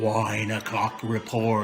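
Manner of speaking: slow, low, dramatic title read; announcer voice. Real, not acted. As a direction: announce the show name with gravitas.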